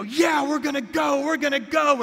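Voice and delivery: hyped-up rallying yell, far above normal pitch; gung-ho cheer. Acted. Believers charging out on their own power, mock gung-ho.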